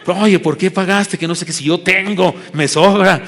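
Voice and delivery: mock indignation, pitched high and loud; enojo fingido. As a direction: act offended, playing it up for laughs. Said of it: "me hice el enojado". (enojado de mentiras, subiendo la voz)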